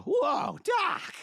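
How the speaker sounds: high, loud startled shout; Marty McFly reply. Retold or imitated. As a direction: yelp it back like a surprised teen hero. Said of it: "he was just all".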